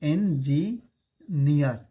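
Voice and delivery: Engineer drawn out very slowly after एन; slow demo reading. Shown as a demonstration. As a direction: read engineer slowly, syllable by syllable. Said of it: "ऐसे पढ़ेंगे".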